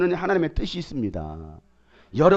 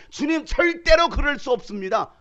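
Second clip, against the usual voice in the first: high, cocky protest; indignant disciple refusing; proud Peter; 10 semitones higher, 3 dB louder than usual. Acted. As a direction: play Peter sure of himself. Preacher acts proud Peter.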